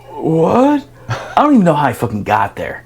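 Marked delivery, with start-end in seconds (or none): 0.1-0.9 dazed what. Retold or imitated. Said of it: "And I'm like".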